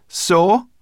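loud, high retort; dismissive. A narrator's reading. Sorrel dismissing Ben's warning, dismissive. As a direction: toss it off, unconcerned.

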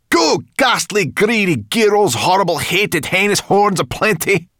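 high, loud shouted string of insults; loud cry. A narrator's reading. Sorrel joins in cursing the elves, loud high cry. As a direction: belt out the insults, high-pitched. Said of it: "cried Sorrel".

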